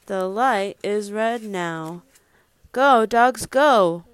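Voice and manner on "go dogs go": raised, louder urging call; cheering on. Acted. Parent sends storybook dogs off for child, raised urging call. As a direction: call them onward with energy.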